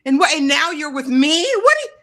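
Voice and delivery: high-pitched, incredulous questioning; hammed-up outrage, pitch jumping up; mock indignation. Acting out his reaction to her engagement, mock indignant.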